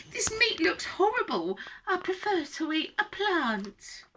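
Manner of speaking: high little voice, slowing at the end; fussy young dinosaur. A narrator's reading. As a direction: turn the food down in a high child-character voice. Fussy young dinosaur refuses meat.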